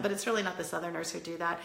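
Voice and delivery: normal talking voice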